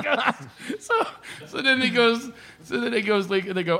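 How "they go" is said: ordinary delivery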